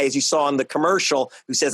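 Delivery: ordinary delivery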